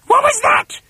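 very high, loud shriek; alarmed screechy wife; startled cry. A narrator's reading. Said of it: "cried Missus Twit".

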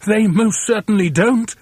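a lightly voiced indignant monkey; Mugglewump crying out. A narrator's reading. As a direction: protest it firmly, voice lifted. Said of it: "cried Mugglewump".

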